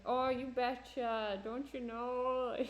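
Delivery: slow, drawn-out mock Minnesota accent. Shown as a demonstration. Showing the classic Minnesota accent as in Fargo, hammed-up Minnesota accent. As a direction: stretch the vowels like a Fargo Minnesotan. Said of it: "the classic Minnesota accent".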